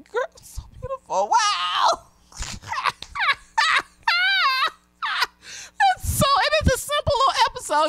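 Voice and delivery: high, teary gush of feeling between laughs; choked-up real emotion; emotional. Real, not acted. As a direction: overcome and half-crying with joy. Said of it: "the drink is making me emotional".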